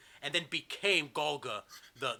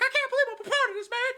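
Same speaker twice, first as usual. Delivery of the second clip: high, squealing wail of disbelief; loud, hammed-up falsetto blubbering; Jimmy Hart crying; 9 dB louder than usual. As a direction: cry it out in a frantic high squeak. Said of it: "Jimmy Hart is crying".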